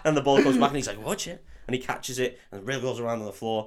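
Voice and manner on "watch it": higher, soft mock Scottish accent; a quick warning in put-on Scots; Oliver Wood. Keeper warning Harry about the ball, Scottish accent. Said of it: "for me to do a Scottish accent".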